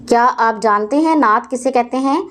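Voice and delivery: ordinary delivery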